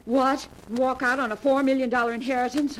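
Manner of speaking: high, loud and incredulous; outraged refusal. Acted. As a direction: explode at the very idea of leaving. Refuses to give up inheritance.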